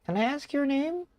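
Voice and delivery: very high, put-on character voice; young character voice. Young character asking a customer's name, very high voice. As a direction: ask politely in a tiny high voice.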